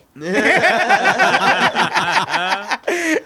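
long, high, loud laugh; loud burst of laughter. Real, not acted. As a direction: crack up hard. Big laugh after punchline.

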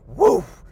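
loud barking woof; hype bark. Real, not acted. Hyped vlogger barking after his chant. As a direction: bark it like a pumped dog.

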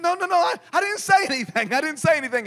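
high, hurried-sounding protest; frightened denial. Retold or imitated. Preacher denies it to the possessed man, acting out fear. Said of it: "I was so afraid, I was shaking and trembling".

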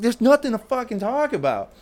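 angry high retort. Retold or imitated. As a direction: throw it back at her hard. Shouting back at ex.

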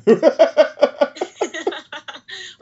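squealing high laugh; high burst of laughter. Real, not acted. Host laughs at guest story.

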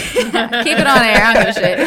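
loud laughing protest; laughing loudly. Real, not acted. Laughing off a crude joke.

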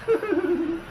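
high tittering laugh; squeaky giggle. A narrator's reading. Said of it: "in her squeaky voice".

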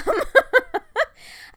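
high, loud bubbling laugh; can't stop laughing from excitement; giddy giggle. Real, not acted. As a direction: burst into a delighted giggle. Giddy after screaming.

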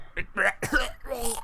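goblin retching on gas; acted choking cough. Game master acts the gassed goblin, choking. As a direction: cough and gag as if poisoned.

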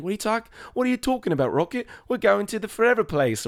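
high, quick cartoonish voice; dim, naive character. Podcaster imitates clueless animal friends questioning Rocket, high naive voice. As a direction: play an innocent who doesn't get it. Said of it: "they're not as sharp as Lila".

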